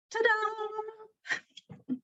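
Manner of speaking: high, soft sing-song ta-da; mock-grand little reveal; playful fanfare. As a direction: unveil it with a light, held high note. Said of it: "needs to be more fanfare".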